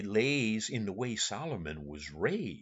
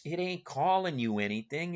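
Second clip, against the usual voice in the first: usual speaking voice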